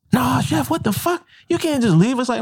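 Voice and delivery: high-pitched coworker begging chef to stay; protesting coworker. Retold or imitated. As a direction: squeak it up like a stunned coworker. Coworkers protest at him leaving.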